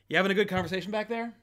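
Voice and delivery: retold sassy call-out, fired off fast; Jersey dad sass. Confronting talkers behind him in the theater, sassy. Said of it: "Jersey slash my dad sass".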